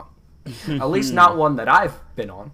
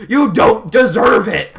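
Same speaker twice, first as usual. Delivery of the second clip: loud, slow outraged scolding; mock scolding shout; 6 semitones higher, 11 dB louder, slower than usual. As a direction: scold the dog loudly, word by word. Scolding the dog.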